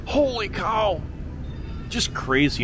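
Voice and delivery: ordinary talk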